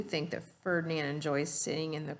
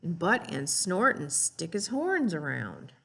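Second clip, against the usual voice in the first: put-on lively read-aloud for young children; storybook reading; 3 dB louder than usual. Teacher reading the class a story, animated read-aloud voice. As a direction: read it like a picture book to the class. Reads picture book aloud to kids.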